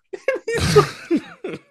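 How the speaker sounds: high, slow words squeezed through laughter; laughing so hard the words stretch out; helpless high giggle; giggling fit. Real, not acted. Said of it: "you're giggle mode today".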